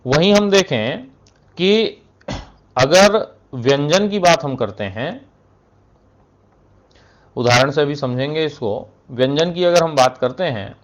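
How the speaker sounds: ordinary delivery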